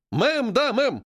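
high, military-style response; drilled chorus reply. A narrator's reading. Seamstresses answer Геля's drill, in obedient shouts. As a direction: answer like recruits on parade.

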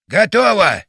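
ringing announcement; exclaimed. A narrator's reading. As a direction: call out that all is ready. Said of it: "воскликнул батя".

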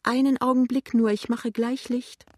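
put-on high-pitched tearful old woman; tearful whine. A narrator's reading. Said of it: "sagt eine weinerliche Stimme". (weinerliche hohe Stimme)

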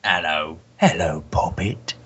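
low, slow Cockney greeting. Pretend. Podcaster retries the impression, low Cockney voice. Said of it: "Muse impression as doing a Cockney voice".

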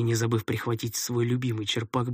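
usual speaking voice

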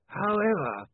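high, drawn-out lawyerly interjection; special lawyer's voice. A narrator's reading. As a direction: stretch it out with oily formality. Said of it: "in his special lawyer's voice".

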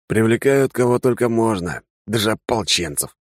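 muffled masked soldier; through a face mask. Acted. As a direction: talk from behind a rubber mask. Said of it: "глухо донеслось из-под маски".

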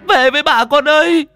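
high, loud tearful wailing; wailing grandmother. Acted. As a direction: call the drowned daughter home through tears. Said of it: "Bà vừa khóc".

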